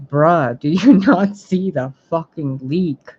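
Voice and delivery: loud, laughing disbelief; exasperated. Real, not acted. Exasperated at viewers' donations.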